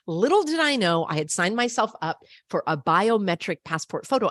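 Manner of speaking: ordinary talk, nothing marked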